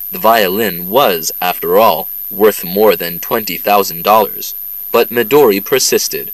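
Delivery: normal talking voice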